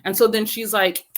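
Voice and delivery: usual speaking voice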